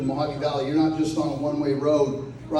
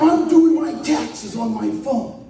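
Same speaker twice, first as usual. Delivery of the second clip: high, slow mock protest; retold indignant excuse; 6 semitones higher, slower than usual. Repeating friend's excuse to the crowd, mock-indignant protest. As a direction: draw out the absurd excuse, pitched up.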